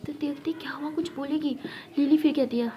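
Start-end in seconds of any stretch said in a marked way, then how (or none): 0.1-1.6 unvoiced curious whisper; whispered aside. Classmate whispering, wondering what Lakshmi will say.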